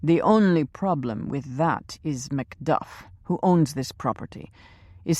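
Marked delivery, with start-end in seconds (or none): none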